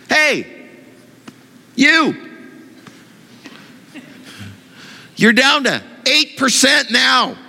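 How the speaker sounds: high-pitched indignant scolding; mock angry diner. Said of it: "get angry like that".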